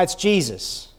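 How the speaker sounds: normal talking voice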